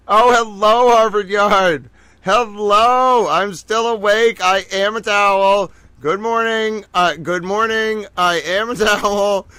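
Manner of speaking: excited hello to viewers. Real, not acted. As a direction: greet them with a burst of energy.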